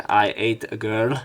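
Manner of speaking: usual speaking voice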